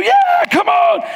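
very high, loud, slow cheering shout; cheering yell. Retold or imitated. Preacher cheering the fallen skier from the lift, loud high yell. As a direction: yell from the lift to fire him up. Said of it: "I started encouraging him too".

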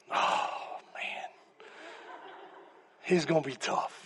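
whispered dread. Retold or imitated. Retold dread aside.

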